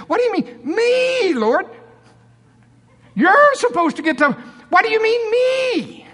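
very high, loud, indignant disbelief; Norval's shocked squeal. Retold or imitated. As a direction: squeak it out, falsetto outrage. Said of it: "Am I doing it pretty good?".

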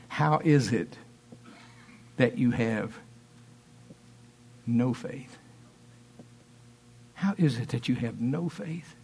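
low, soft, grave question as Jesus; quiet rebuke. Acted. Jesus to frightened disciples, quiet rebuke. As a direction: let the disappointment sit low, with long pauses.

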